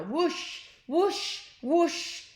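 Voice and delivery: high rising whoosh sounds; whooshing blobs. Shown as a demonstration. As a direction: whoosh them off into the sky. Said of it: "sound effect narration".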